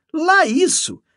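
very high, loud enthusiasm; exclaiming. A narrator's reading. Canon insisting she is well preserved, exclaiming. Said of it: "exclamou o cônego parando outra vez".

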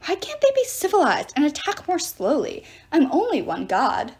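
high-pitched, fussy whine of protest; complaining. A narrator's reading. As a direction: complain like an overworked official. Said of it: "Terminus complained".

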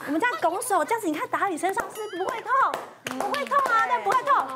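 high, hurried pleading to calm her; nervous students pleading with the mother; flustered reassurance. Retold or imitated. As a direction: rush to reassure, a bit panicked.

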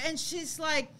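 normal talking voice

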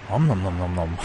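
low, munching nom nom sounds; nom nom chomping. Retold or imitated. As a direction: chomp it like a hungry cook. Imitating a chomping cook.

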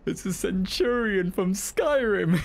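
high, slow, can't-believe-it; incredulous. Real, not acted. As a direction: say it in squeaky disbelief. Shocked by the boss.

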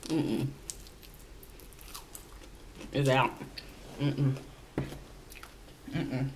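muffled mid-bite speech; chewing while talking. Real, not acted. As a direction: mouth full of food. Seen 2: Bites and chews chicken wing, mouth visibly full while humming.

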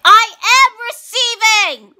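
high, loud exasperated shout; frustrated yell. Retold or imitated. Vlogger yelling back at her ancestors, exasperated. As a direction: yell it in mock outrage at the spirits. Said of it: "I was so mad".

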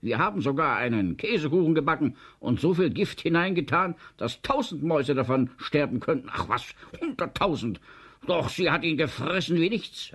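high, agitated rush of words; aufgeregt. A narrator's reading. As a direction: protest breathlessly fast to the boss, pitched high. Flustered employee defends himself to director. (aufgeregt und hastig, mit hoher Stimme)

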